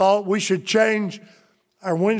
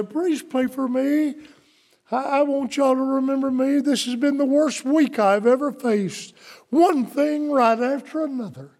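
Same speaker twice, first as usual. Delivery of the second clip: whiny church lady; 6 semitones higher than usual. Retold or imitated. Dear lady voiced, complaining.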